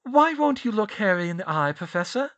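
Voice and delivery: high, loud, mounting accusation from a clever student; Hermione accusing. A narrator's reading. Student piecing it together and accusing headmaster, high insistent voice. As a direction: list his faults at him, pitched high.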